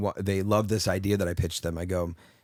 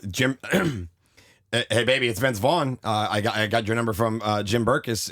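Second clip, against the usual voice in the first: Vince Vaughn impression; 2 semitones higher than usual. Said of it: "I just went right into Vince Vaughn".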